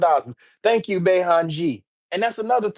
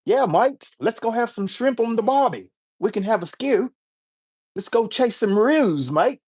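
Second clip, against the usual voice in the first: high-pitched mock Australian accent; 6 semitones higher than usual. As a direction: put on a hammy Aussie voice, pitched high. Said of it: "I got an Australian accent too".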